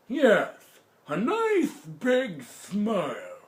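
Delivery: put-on high, slow old man voice; coaxing grandpa. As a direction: coax it like a sly old grandpa.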